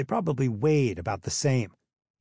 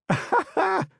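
high giggling cackle; high mocking laugh at the new boy; high burst of laughter. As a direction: crack up like a hyena.

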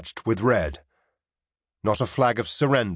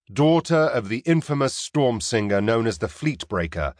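ordinary delivery, nothing marked

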